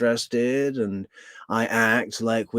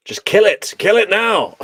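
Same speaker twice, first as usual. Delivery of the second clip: high, loud plea to stop; exasperated shout; 10 semitones higher, 8 dB louder than usual. Real, not acted. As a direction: yell at them to stop it. Begging to stop the clip.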